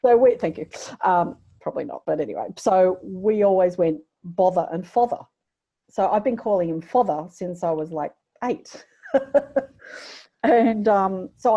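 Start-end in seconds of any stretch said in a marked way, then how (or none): none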